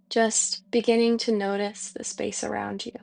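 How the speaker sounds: soft, unhurried meditation voice with long pauses; guided meditation. Real, not acted. Teacher easing a listener into breath and release, guided meditation voice. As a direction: guide them softly into release, leaving space to breathe.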